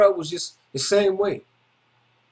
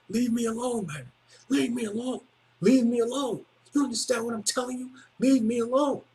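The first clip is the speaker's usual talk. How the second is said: higher, softer, intense "Leave me alone"; serious warning; 4 semitones higher, 4 dB quieter than usual. Retold or imitated. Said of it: "And I was serious".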